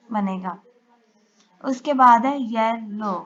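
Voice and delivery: normal talking voice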